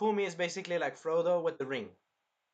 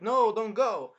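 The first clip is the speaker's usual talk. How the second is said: higher, louder mock plea; pleading cry; 7 semitones higher, 8 dB louder, slower than usual. Companion begs hero to stay, raised pleading cry. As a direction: call out to stop someone leaving.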